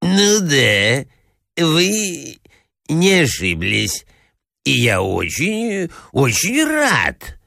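halting, drawn-out prince, climbing high at the end; uncomprehending prince. A narrator's reading. Prince agrees with the hostess without understanding, halting and drawn-out. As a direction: agree vaguely, stretching each phrase. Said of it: "совершенно не понимая".

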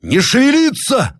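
very high, slow roared order; thunderous shout. Acted. Captain to frightened soldiers, thunderous high shout. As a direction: roar it with a thunderous voice. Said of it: "закричал громовым голосом Зарядьев".

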